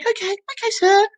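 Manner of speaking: high mock beautician voice. Beautician soothing a client, high mock voice.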